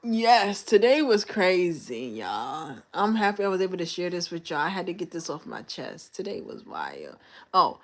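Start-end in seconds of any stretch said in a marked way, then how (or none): none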